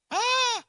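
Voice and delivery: high, loud "ah"; sudden high-pitched yell; imitated crowd outcry. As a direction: cry out like a roused congregation. Imitating the roused congregation.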